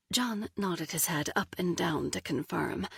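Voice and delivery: ordinary delivery, nothing marked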